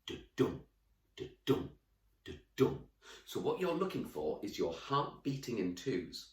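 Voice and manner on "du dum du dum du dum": heartbeat rhythm. Shown as a demonstration. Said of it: "the way that the heart beats is it goes in twos like this".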